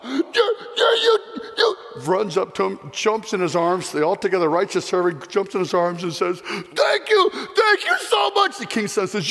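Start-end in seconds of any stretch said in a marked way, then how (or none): 0.0-1.8 high tearful stammered cry; overwhelmed with joy. Acted. Servant sees risen son. 6.4-8.6 tearful thanks. Acted. Jumps into his arms.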